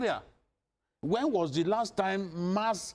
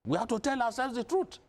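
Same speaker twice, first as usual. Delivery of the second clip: higher pitched, impassioned appeal; 5 semitones higher than usual. Real, not acted. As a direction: plead passionately with the northern leaders. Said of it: "I've never had to speak like this".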